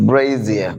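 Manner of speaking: "Brazier" as low, falling BRAY-zee-uh; correct pronunciation. Shown as a demonstration. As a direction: repeat "Brazier" carefully with a buzzed z. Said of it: "It's brazier".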